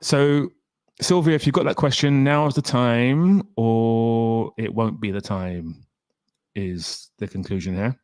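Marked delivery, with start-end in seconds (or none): none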